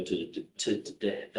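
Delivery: tripping over the word; repeated false starts, fading out; stumbling stutter. Real, not acted. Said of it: "excuse me, Your Honor, tongue twister".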